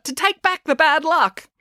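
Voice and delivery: high, slow, emphatic instruction; urgent order. A narrator's reading. Matilda orders Finn to undo bad luck, urgent. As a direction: stretch it out high and insistent. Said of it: "ordered Matilda".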